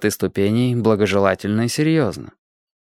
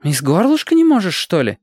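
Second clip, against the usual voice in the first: put-on higher, louder indignant girl; 8 semitones higher, 3 dB louder than usual. A narrator's reading. Zhenka scolds the fussy boy, higher and indignant. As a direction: scold him, outraged. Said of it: "возмутилась Женька".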